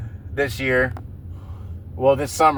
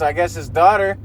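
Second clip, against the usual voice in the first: ordinary delivery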